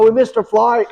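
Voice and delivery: high, loud imitation Australian; Australian accent. Australians back from the bars explaining themselves, imitated Australian accent. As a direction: confess loudly in a put-on Australian accent. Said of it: "this Australian accent".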